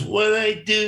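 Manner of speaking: imitating a thick Brazilian accent. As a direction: play a Brazilian fighter, a bit hammed-up. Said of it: "really thick Brazilian accent".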